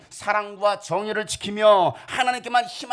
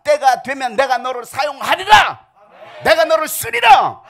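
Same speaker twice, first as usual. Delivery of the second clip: steady call swelling into a louder, higher shout; voice of God; 6 semitones higher, 7 dB louder than usual. Acted. God calling Yongseong to his purpose, building to a shout. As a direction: let God's promise build to a proclamation.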